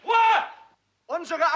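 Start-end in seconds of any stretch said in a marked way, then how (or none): 0.0-0.4 barked command for silence; shouted order; shouting. Acted. Storm trooper silencing the room.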